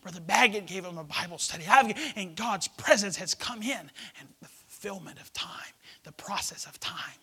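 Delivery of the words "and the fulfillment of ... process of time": whispering. Acted. Whispered preaching.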